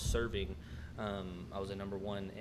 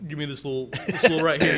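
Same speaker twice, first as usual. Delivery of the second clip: raised, higher mock-demanding voice; mimicked demanding customer; 6 semitones higher, 13 dB louder than usual. Lingering customer pops round the corner at manager, mimicked demand. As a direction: imitate the customer butting in loudly.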